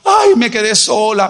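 mock woe-is-me, drawn out slowly; milk the loneliness for sympathy; slow hammed-up poor-me wail; self-pitying lament. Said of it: "no utiliza el momento para sacar provecho de su necesidad".

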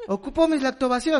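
high mock-distressed whine; hammed-up high-pitched complaint; imagined whining tycoon. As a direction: whine for your missing treat. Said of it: "va a decir así de".

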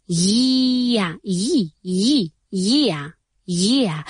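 "Year" with a long yee, ending in uh; soft Y glide. Shown as a demonstration. Teacher correcting learners, "year" drawn out from a soft "yi". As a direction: isolate "yi", then build "year" as yee-uh. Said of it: "no, year tampoco".

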